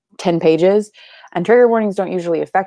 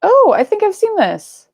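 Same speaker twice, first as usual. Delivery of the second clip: excited; 13 semitones higher, 5 dB louder, slower than usual. Real, not acted. Recognizing a book.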